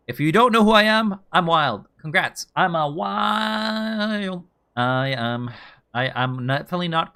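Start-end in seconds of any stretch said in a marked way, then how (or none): 2.6-4.4 drawn-out. Pretend. Joking self-introduction, drawn out.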